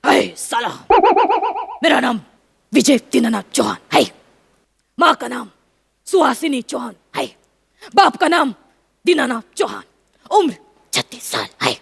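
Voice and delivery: deep bass movie-hero voice, broken by pauses; Amitabh Bachchan. Shown as a demonstration. Wife showing husband a bass voice, deep and measured. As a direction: drop right down into the chest, phrase by phrase. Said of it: "Amitabh बच्चनच्या, अ, आवाजात try करा, बेसच्या आवाजात".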